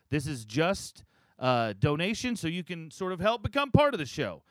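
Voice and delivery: upbeat podcast promo read. Acted. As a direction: pitch it like a sponsor spot. Recorded Patreon ad read.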